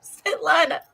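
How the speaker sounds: high-pitched pleading whimper; mock crying. Client pleading with Russian masseuse, mock crying. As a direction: whine her name as if in tears. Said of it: "she makes me cry".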